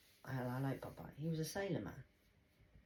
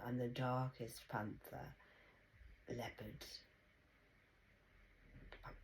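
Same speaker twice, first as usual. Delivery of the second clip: normal talking voice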